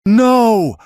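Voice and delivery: a piercing high shout of refusal; anguished outcry; cried out. A narrator's reading. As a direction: scream the no with everything. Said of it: "she cried, her voice rising".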